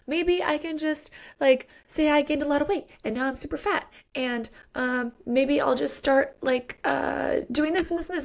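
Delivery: high, rushed, frantic scheming; panicky Penny. Retold or imitated. Pregnant Penny to friends, high frantic panic. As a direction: babble out half-baked hiding plans. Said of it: "She's like".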